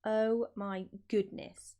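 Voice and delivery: ordinary delivery, nothing marked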